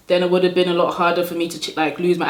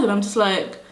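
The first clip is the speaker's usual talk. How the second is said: ordinary delivery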